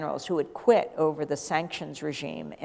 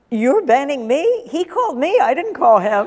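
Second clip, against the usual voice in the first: incredulous laughing protest, much higher and louder; laughing disbelief; 8 semitones higher, 9 dB louder than usual. Real, not acted.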